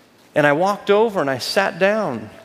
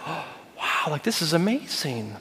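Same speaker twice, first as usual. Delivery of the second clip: awed whisper; 8 dB quieter than usual. Retold or imitated. Inner thought at coaches' table.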